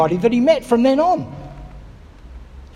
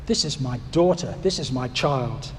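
tender blessing; 8 semitones lower, 5 dB quieter than usual. Pretend. God's words, slow, soft, lower.

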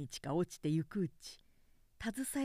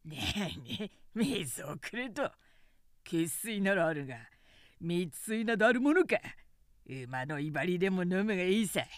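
a hint of a jeering peasant, louder mid-line; mocking farmer; 3 dB louder than usual. A narrator's reading. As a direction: sneer at the beggar lord. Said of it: "百姓男が笑って答えた".

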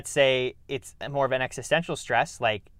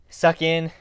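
louder, higher, quick gulp of air; sharp inhale demo; 4 semitones higher, 7 dB louder than usual. Shown as a demonstration. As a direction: suck the breath in hard. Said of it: "I do it very fast the first time".

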